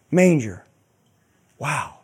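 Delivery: ordinary delivery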